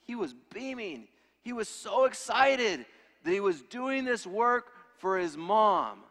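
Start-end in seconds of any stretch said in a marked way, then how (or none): 1.4-6.0 excited. Acted. Preacher describing excited son.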